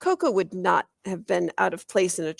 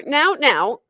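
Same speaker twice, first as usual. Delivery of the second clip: higher, louder now; calling for order; 7 semitones higher, 7 dB louder than usual. A narrator's reading. Librarian hurrying over to break up the fight. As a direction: call the kids to order. Lawn monitor calls out.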